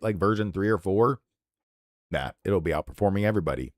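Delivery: ordinary talk, nothing marked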